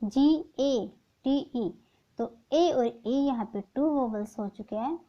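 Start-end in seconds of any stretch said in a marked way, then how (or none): 0.0-1.7 each letter of GATE drawn out very slowly; very slow spelling. Shown as a demonstration. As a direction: stretch every letter, slowest take. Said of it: "तो GATE".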